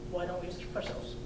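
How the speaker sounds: normal talking voice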